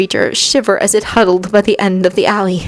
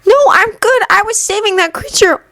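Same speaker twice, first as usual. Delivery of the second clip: put-on high, earnest boyish protest; pleading boy. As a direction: insist on your innocence. Anakin defending himself.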